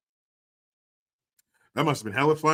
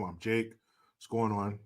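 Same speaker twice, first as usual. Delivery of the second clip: usual speaking voice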